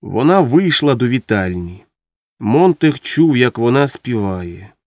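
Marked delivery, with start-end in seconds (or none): none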